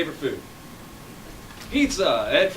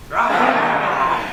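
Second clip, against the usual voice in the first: loud crowd-game tiger roar; big playful shouted "rawr"; 7 dB louder than usual. Pretend. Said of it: "strike this pose and go".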